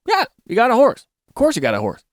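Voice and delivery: shrugging, of course you got a horse; it's nothing special, say it casually; matter-of-fact. Retold or imitated. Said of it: "that sentence would be like".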